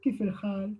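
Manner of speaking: kif el hal, kaifa squeezed to one short syllable kif; dialect kif. Shown as a demonstration. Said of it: "después se pronuncia kif".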